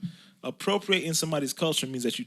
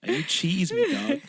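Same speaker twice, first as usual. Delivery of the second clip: higher-pitched mock Canadian accent; hammed-up Canadian slang impression; 7 semitones higher, slower than usual. Shown as a demonstration. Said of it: "how the Canadian thing go again?".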